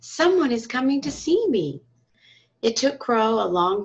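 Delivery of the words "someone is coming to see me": lifted, brighter and higher; joyful thought. Acted. The lonely sun sees someone coming, joyful. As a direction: let the joy rise in his voice. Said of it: "the sun thought joyfully".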